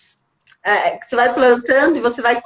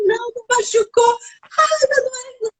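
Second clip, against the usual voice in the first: put-on higher, whiny little girl, crying; hurt child whining; 11 semitones higher than usual. Mother retelling her fallen daughter's crying, higher and whiny. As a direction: voice the hurt daughter half sobbing. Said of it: "E ela gritava".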